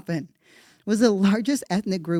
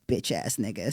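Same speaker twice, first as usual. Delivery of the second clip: muttered insult; 10 semitones lower, 8 dB quieter than usual. Real, not acted.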